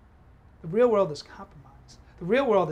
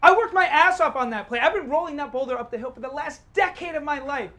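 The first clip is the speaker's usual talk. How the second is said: loud, raised-pitch outburst; furious defiance; 6 semitones higher, 11 dB louder than usual. Acted. Man defends his play to fiancée, furious. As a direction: defend yourself hotly, voice pushed up.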